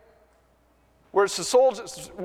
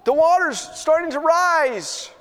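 much higher, urging call from the boat; urgent higher mock shout; retold yell; 9 semitones higher than usual. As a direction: plead with him to jump aboard. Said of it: "yelled out to him".

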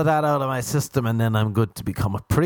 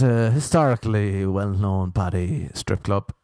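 usual speaking voice

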